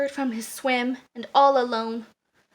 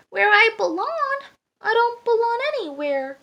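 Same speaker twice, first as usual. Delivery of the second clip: much higher, plaintive duckling; dismayed; 9 semitones higher than usual. A narrator's reading. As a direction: protest plaintively that you have no home. Duckling hears he must leave.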